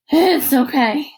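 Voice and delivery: slow, strained wheeze; mild breathless wheeze; wheezing. A narrator's reading. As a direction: squeeze it out, winded. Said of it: "Hector wheezed".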